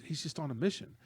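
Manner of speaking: ordinary delivery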